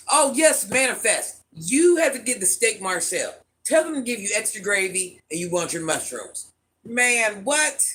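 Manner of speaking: ordinary delivery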